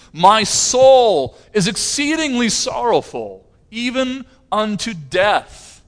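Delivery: high, loud, slow declaration of sorrow; solemn anguished testimony. Retold or imitated. Jesus confiding his anguish to disciples in Gethsemane, sorrowful and loud. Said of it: "Jesus even testified to that".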